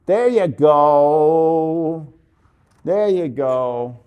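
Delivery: loud, long-stretched praise; loud and very slow, savoring it; drawn-out approval. Real, not acted. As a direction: stretch out the praise as the pose lands. Praising model as pose lands.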